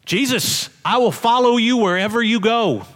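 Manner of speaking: high, loud vow to follow; eager declaration. Acted. Follower pledging himself to Jesus, eager declaration.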